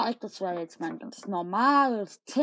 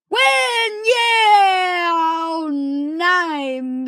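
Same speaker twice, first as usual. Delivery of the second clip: very high, loud cheering yell; hammed-up triumphant scream; victory shout; 6 semitones higher, 9 dB louder, much slower than usual. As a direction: scream with joy at the win. Victory cheer then dismay.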